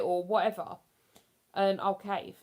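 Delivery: ordinary talk, nothing marked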